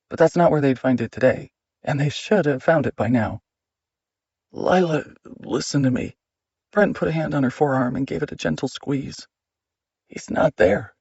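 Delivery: plain reading voice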